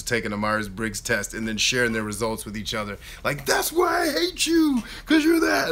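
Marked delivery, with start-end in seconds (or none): 3.5-5.7 high, loud mock shout of a relative; joking family accusation. Relative jokingly blaming family member, mock shout. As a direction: squeal it out in jest like an accusing cousin. Family sharing personality test results.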